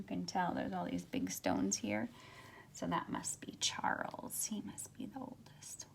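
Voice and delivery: whispered aside over the book; hushed whisper. Real, not acted. Teacher whispering to students about the picture, hushed. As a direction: lean in and breathe it to the class.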